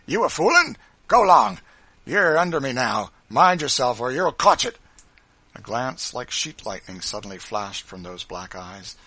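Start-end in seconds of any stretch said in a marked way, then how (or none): 0.0-4.8 loud, high bullying shout; gloating overseer's threat; triumphant. A narrator's reading. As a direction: crow over someone in your power. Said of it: "with an air of triumph".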